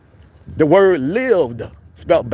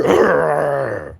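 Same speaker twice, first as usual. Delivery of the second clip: short mock monster growl; angry growl; 2 semitones lower than usual. Answering a friendly hello with an angry growl. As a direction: snarl back at the greeting. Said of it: "Just wake up angry".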